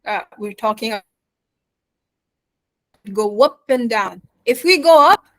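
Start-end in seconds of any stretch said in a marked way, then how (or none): 4.4-5.2 rising pitch to show emphasis; higher and louder to show importance; voice going up. Shown as a demonstration. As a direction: lift the voice high and loud on this. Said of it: "As you can hear my voice".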